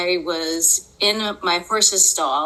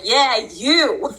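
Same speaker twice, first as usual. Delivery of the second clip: retold yell; 5 semitones higher, 4 dB louder than usual. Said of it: "because I'm literally yelling it".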